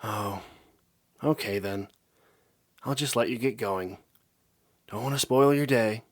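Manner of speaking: guilt-trip him with mock sadness; a touch of put-on sulking; fake sad mumble. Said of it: "gave her best sad impersonation and mumbled".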